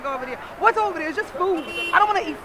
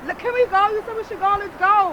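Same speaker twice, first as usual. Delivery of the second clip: higher, louder, impatient push; 5 semitones higher, 6 dB louder than usual. Acted. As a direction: press him to just go. Impatient argument in public.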